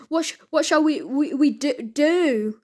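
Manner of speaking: frightened, halting stutter; scared stammer. A narrator's reading. Scared Slime asks Ned for help, scared stammer. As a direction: stumble over each word nervously. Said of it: "the pair were scared".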